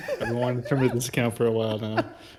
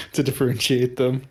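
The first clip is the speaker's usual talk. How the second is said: ordinary talk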